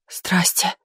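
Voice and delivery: soft voiceless greeting; barely breathed, whispered hello; rustling whisper. A narrator's reading. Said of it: "прошелестели актрисы".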